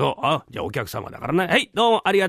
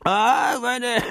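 tipsy delight; 8 semitones higher than usual. Acted. Drunk brother savouring the sake.

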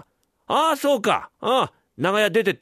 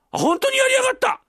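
very high, screeched outrage; hysterical wife; 4 dB louder than usual. Acted. Wife screaming at the husband who just struck her, hysterical.

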